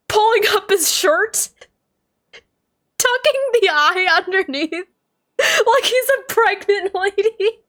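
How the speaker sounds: high, helpless laughter breaking through the apologies; can't stop giggling, squeaky and high; giggling fit. Real, not acted. Streamer to chat, cracking up at the pregnant-Dainsleif image, high and giggly. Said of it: "I had to laugh".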